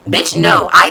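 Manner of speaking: sudden loud, blunt no; loud outburst. Real, not acted. Telling her pan story, a loud exasperated outburst. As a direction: blurt it out, loud and fed up.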